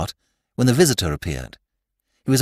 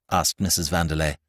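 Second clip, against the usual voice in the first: ordinary delivery, nothing marked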